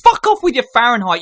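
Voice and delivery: loud, high-pitched outburst; exasperated heckle. Real, not acted. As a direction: cut in with comic outrage. Heckles the column's use of Fahrenheit.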